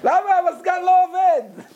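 high frantic shouting at the staff; shouting seller. Acted. Shopkeeper yelling at his staff to hurry, high frantic shout. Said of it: "צועק".

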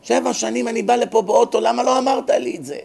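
high, strained mock scream of blame; mock screaming accusation. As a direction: shriek the blame at the rabbi. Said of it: "התחיל לצרוח עליו". (צעקה מאשימה מדומה, גבוהה)

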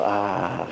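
low sigh of relief; imitated contented exhale, pitch dropped; relieved "ahh". Boy wrapped in a warm towel, relieved sigh. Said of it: "אז הילד אמר".

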